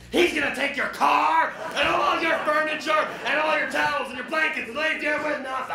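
loud, high shouted list; shouted threat. Acted. Elf shouting at the mother, loud and high. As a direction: yell it all out at her.

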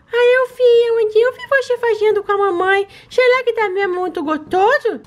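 high, sweet little pet voice begging for treats; baby-voiced pet. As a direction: talk like a greedy cute puppy asking mommy. Another pet speaks.

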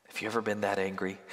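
ordinary talk, nothing marked

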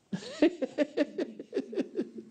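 real laugh, high and rising; a real, high-pitched laugh. Real, not acted. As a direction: burst out laughing at his own joke. Laughs after strangle remark.

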